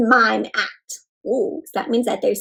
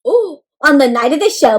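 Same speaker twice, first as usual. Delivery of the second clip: ordinary delivery, nothing marked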